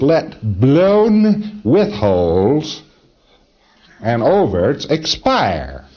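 slow, grand recitation; mock Shakespearean. Shown as a demonstration. Lecturer mocks a solemn Shakespearean line, slow and grand. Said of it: "The Shakespearean phrase of".

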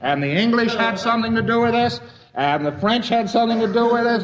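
mock protest. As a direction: push back like a defensive tourist. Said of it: "You say".

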